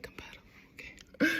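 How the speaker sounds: whispered, unvoiced echo; retold whisper. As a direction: whisper it to yourself, puzzled. Retold confused mumbled word.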